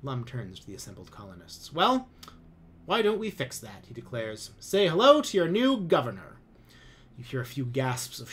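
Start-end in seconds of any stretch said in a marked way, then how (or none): 1.7-6.4 much higher, louder smug announcement; pompous commander. A narrator's reading. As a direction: savour the big reveal. Lum announces new governor.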